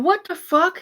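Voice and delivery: shocked reaction. Retold or imitated. Reacting in disbelief to the threat, a high shocked outburst. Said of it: "I'm like".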